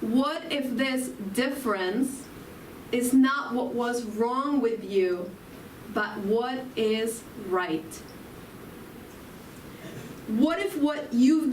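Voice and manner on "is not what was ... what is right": usual speaking voice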